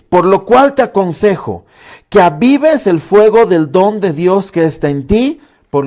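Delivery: loud, ringing verse reading; emphatic scripture reading. Acted. Pastor reading Paul's advice to Timothy aloud, emphatic proclamation.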